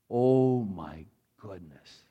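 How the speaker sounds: very slow, drawn-out hushed wonder; awed. Retold or imitated. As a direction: let the sight take your breath. First view of the sea.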